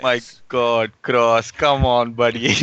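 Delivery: loud, teasing groan at a buddy; exasperated teasing. Real, not acted. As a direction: rib him for the bad roll. Teasing over bad dice roll.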